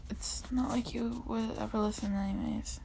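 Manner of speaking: soft sad whisper. Acted. Reading a sad comic line in a whisper. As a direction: let it fall to a whisper.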